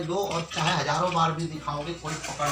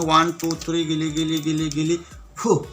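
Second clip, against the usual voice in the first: put-on kids party magician; 5 dB louder than usual. Magic incantation.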